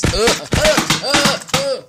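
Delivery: high loud choking gasps. Acted. A guest choking, high loud gasps. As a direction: splutter as if choking.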